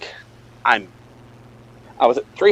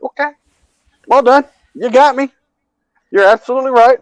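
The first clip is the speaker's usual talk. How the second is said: mock-gracious, higher and louder; sarcastic concession; 10 semitones higher, 3 dB louder than usual. Husband mockingly conceding to his wife, sarcastic. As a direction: concede with exaggerated praise.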